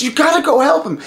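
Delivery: high-pitched frantic insistence; urgent plea. Acted. Wife insists he go help, urgent and high. Sita urges him again.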